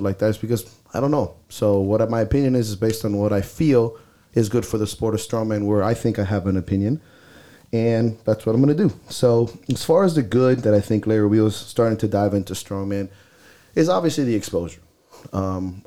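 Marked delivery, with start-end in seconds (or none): none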